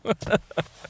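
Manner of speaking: short high-pitched laugh; amused laugh. Real, not acted. Host tripped on a word, laughing at themselves. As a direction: laugh at your own flub.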